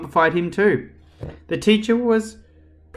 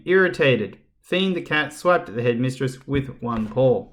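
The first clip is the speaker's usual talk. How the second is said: plain reading voice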